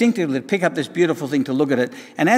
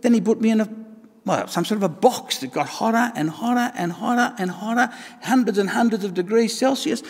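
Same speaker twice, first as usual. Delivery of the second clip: ordinary talk, nothing marked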